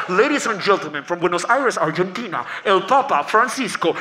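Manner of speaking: anuncio en inglés de estadio; anunciador de concierto. Acted. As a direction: presenta al Papa como a una estrella. Presentador anuncia al Papa. (anunciador grave y solemne)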